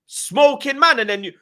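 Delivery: high, loud retold tirade; pissed off. Listing to Robbie all he's done, pissed off. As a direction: reel off your grievances at high pitch. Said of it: "getting pissed off".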